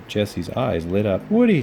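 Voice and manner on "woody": high, loud happy cry of his name; overjoyed to see him; joyful cry. A narrator's reading. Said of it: "she cried".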